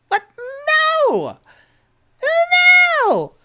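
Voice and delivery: high, loud laughing no; panicked denial. Acted. Tipsy denying he works for the Xanathar Guild, panicked. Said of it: "And Tipsy goes".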